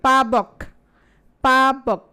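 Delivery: পাবক said loud and slow, twice; stress on পাবক. Shown as a demonstration. Teacher repeating the example word firmly. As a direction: lean on পাবক to show the আব. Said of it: "হ্যাঁ, দেখো".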